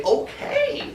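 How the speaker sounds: very high squeaky squirrel agreeing; squirrel okay. Acted. Teacher voicing the squirrels agreeing, very high squeak. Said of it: "We all say".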